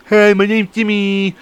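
high, drawn-out goofy greeting; over-the-top put-on character. Introducing himself to his friend's cousin, over-the-top put-on voice. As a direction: ham it up, slow and high. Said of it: "it's over the fucking top".

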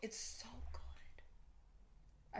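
slow whispered rave; hushed, very slow whisper. Real, not acted. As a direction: breathe it out in a whisper. Whispers praise of show.

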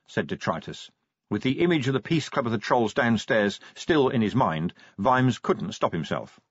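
plain reading voice

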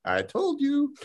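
gloating granny. Acted. Granny with a sniper rifle taunting a soldier, high and sing-song. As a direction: tease like a sweet granny taking revenge.

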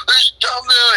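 high-pitched imitation of an angry dad; frustrated, mad dad. Son imitating his dad watching Bears games, frustrated and mad. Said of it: "gets so frustrated and so mad".

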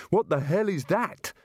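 high-pitched startled question; alarmed outcry. Acted. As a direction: cry out in panicked disbelief. Startled as cart shoots forward.

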